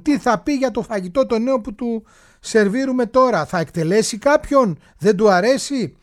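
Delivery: hammed-up nervous questioning, voiced for the cooks; anxious palace cooks. Palace cooks trembling before the Sultan's verdict, anxious questioning.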